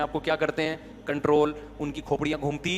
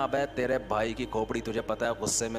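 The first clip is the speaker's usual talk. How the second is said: lightly voiced swaggering lad, quieter; mock street boy boast; 7 semitones lower, 7 dB quieter than usual. Preacher mimics boys bragging about their temper, lightly voiced swagger. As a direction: brag like a hothead youth, low-key. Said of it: "جو لڑکے عام طور پہ کہتے ہیں".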